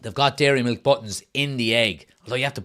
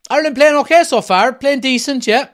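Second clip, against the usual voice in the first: live commentary; 12 semitones higher, 10 dB louder than usual. Acted. Commentary style.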